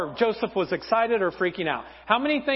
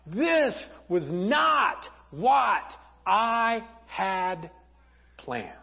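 anguished plea to God; much slower than usual. Acted. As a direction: cry out to God, then sink in defeat. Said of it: "he looks up to God, and he's like".

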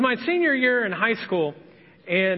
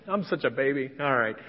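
normal talking voice

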